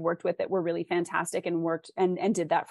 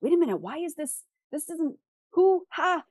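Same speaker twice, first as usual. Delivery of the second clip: baffled reader; 10 semitones higher than usual. Retold or imitated. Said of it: "and I'm like".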